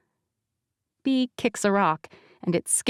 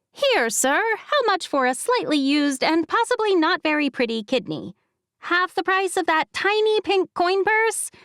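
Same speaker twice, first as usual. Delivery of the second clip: lightly higher, bubbly friend voice; a playful young woman haggling; B's voice; 10 semitones higher than usual. Acted.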